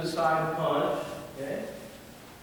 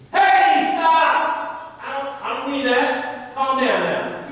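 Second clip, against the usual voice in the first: high, loud command easing off slightly; cop-style yell; 8 semitones higher, 5 dB louder, much slower than usual. Shown as a demonstration. Coach shows interrupting an attacker, high yell that eases down. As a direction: shout to interrupt an attacker mid-thought. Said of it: "Why do cops yell at people?".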